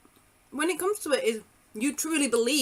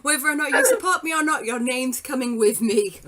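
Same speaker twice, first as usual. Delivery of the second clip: bossy demand; 3 semitones higher, 7 dB louder than usual. Pretend. Mock pushy demand, laughing.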